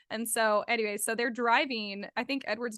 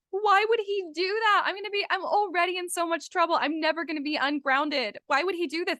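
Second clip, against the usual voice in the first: loud, high-pitched indignant protest; retold outburst of exasperated betrayal; acted outrage; 6 semitones higher, 4 dB louder than usual. Book reteller voicing the girl upset over the motorcycle, outraged.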